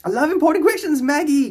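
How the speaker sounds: high, loud, eager welcome; excited greeting. Real, not acted. Welcoming Maggie's question, excited. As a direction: greet her brightly.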